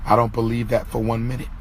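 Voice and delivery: normal talking voice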